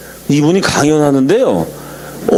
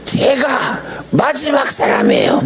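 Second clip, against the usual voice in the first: much higher and slow, stretching '마지막' in a mock cry; retold emotional cry; 11 semitones higher, slower than usual. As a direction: wail '제가 마지막 사람이에요' slowly and high, overcome. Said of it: "막 소리를 지르면서".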